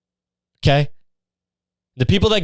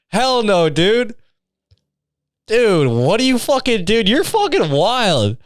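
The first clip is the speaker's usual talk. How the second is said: mock disgusted girl; 7 semitones higher, much slower than usual. Mocking the girls' disgusted reaction.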